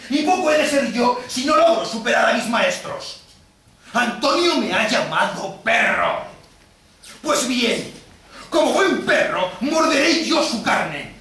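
high, loud, building vengeful tirade; vengeful fury. Acted. Wronged moneylender demands justice under the contract with Antonio, vengeful fury. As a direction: declare revenge at full voice. Shylock vows revenge in court.

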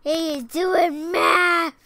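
loud, slow, delighted shout; excited shout. Real, not acted. Child shouting to parent about the octopus, excited. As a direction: blurt it out with glee.

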